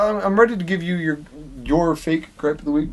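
ordinary delivery

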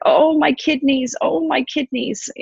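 high moaning cry of pain; mock wailing goddess. Ereshkigal screaming to the little beings in pain, high wail. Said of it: "is screaming".

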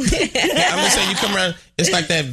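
laughing about lingerie. Real, not acted. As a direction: laugh as you explain. Laughs while explaining.

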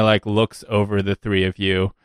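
ordinary talk

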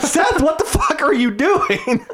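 high, slow, laughing retold yell at a friend; laughing mock yell. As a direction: yell at him through laughter, half-joking. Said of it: "I'm like".

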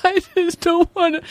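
high, whimpery imitation of a kid; mock crying child. Mother retelling her son's homework upset, mock crying. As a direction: imitate a child close to tears. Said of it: "he starts kinda crying".

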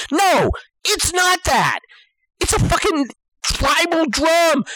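high-pitched indignant rant; incredulous comic outburst; exasperated insistence. Acted. Exasperated rant about drum.

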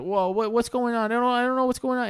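put-on high panicky kid voice; panicked kid. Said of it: "Timmy at this point was like".

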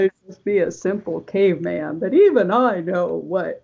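a hint of a dim caveman; caveman lawyer impression. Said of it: "the best Unfrozen Caveman Lawyer impression".

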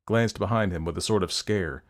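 usual speaking voice

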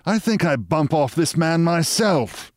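lightly voiced boss making a threat; lounging boss. A narrator's reading.

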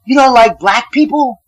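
high-pitched mock scream; retold scream. Homeless guy accusing him in front of everyone, a mock scream. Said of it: "he just screamed".

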